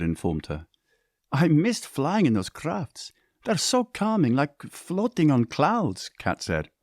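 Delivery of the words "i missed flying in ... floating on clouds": dreamy. A narrator's reading. Kat dreamy about flying.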